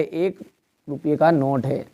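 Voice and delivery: ordinary talk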